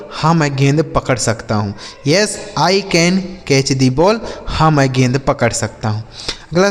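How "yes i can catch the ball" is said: slow, careful English question; slow model reading. Shown as a demonstration. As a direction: read it out slowly for learners. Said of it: "slow model answer repeated".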